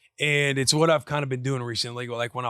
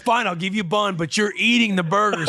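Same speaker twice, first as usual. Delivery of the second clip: higher, louder, half-laughing concession; giving in, dad; 7 semitones higher, 5 dB louder than usual. Retold or imitated. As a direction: give in but set the rule. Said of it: "And so I'm like".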